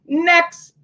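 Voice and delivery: high, curt "Next"; a hint of a bored recruiter; dismissive interviewer. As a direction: brush the candidate off sharply. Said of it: "they're gonna say".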